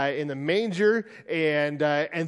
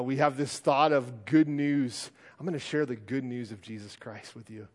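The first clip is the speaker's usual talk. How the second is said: ordinary talk, nothing marked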